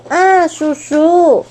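joyful greeting from the sky; glad call. Acted. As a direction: call out to the uncle slowly. Bird spots uncle.

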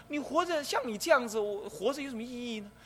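mock the dying person's worth; lightly acted contempt for the sick; scornful mock remark. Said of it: "贪欲死".

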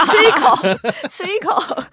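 high, loud, laughing outburst; laughing joke. Real, not acted. Podcaster joking about the fog as cotton candy, laughing. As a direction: blurt it out through real laughter, high and loud.

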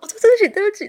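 high, loud flustered sorry; hammed-up embarrassed apology; retold apology. (对医生夸张地连声道歉)

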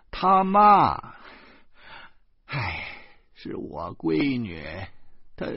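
grandma finally breaking the news of her daughter's death; stunned grief. Acted. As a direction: say it dazed after a long pause, stressing 说. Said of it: "过了好久才说".

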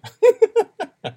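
a high, loud laugh bursting out; shrieking laugh; loud burst of laughter. Real, not acted. Laughs at the story.

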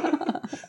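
a sharp high giggle; high burst of laughter. Real, not acted. Laughing after admitting Yaoyao is his wife, high giggle burst.

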